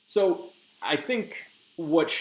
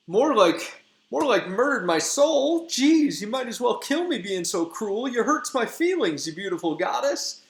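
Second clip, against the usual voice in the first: much higher and louder, hammed-up heartbreak; lovesick Demetrius; 11 semitones higher, 4 dB louder than usual. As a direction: wail like a wounded puppy in love.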